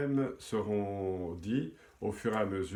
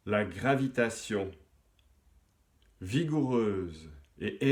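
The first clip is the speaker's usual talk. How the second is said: measured poem reading with long pauses; poetry recitation; 3 dB louder than usual. Acted. Host reciting a listener's poem to the audience, measured poetry recitation. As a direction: recite verse phrase by phrase, letting key words swell.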